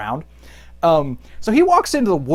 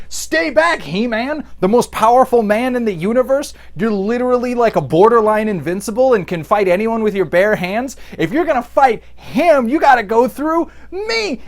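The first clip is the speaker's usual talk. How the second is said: raised, high-pitched defiance; hammed-up protective challenge; mock heroic defender; 5 semitones higher than usual. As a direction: step in bravely, pitched up throughout. Teela's defiant challenge, mocked.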